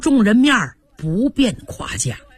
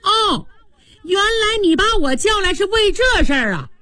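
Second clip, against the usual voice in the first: put-on much higher, offended boy crying out; indignant outburst; 11 semitones higher than usual. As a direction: flare up, stung by the question. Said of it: "嚷起来了". (拔高嗓门气冲冲地嚷)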